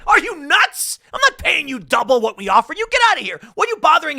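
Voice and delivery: very high, loud comic tirade; mock outraged shout. Company refuses to pay double, exaggerated high-pitched yelling. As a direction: throw them out in hammed-up disbelief. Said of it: "They didn't really say it like that".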